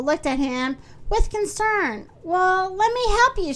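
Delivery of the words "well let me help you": higher-pitched offer to help; a touch of eager storybook cry; mild eager cry. A narrator's reading. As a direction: pipe up as a keen helper. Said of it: "she cried".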